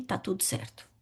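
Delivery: breathy whisper, almost no voice; whispered reassurance. Real, not acted. Trusting that Jesus steers, whispered reassurance. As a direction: let it fall to a hush of trust.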